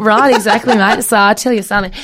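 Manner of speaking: loud, laughing Aussie impression; Australian accent. Guest playing along with host's praise, hammed-up Australian accent. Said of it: "it's like Nicole Kidman's in the room".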